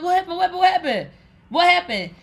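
high, rapid, repeated panicky questions; alarmed questioning. Retold or imitated. Man answering her crying phone call, alarmed. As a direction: imitate a worried man firing questions.

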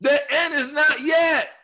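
high, slow, drawn-out declaration; emphatic scripture proclamation. Acted. Pastor quoting Jesus to correct preachers, high slow proclamation. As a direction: proclaim it firmly, stretching each word.

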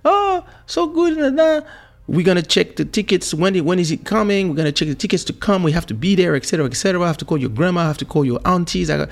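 high, rushed excited mother; mom's voice. Retold or imitated. Mom excitedly planning tickets, high and quick. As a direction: mom planning the trip in a rush.

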